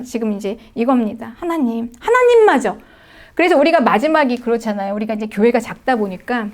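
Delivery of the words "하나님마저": high, loud anguished outcry; anguished cry. Acted. Preacher crying out to God, high and loud. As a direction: cry it out as if abandoned.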